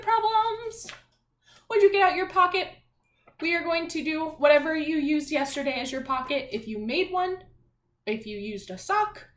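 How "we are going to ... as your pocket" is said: usual speaking voice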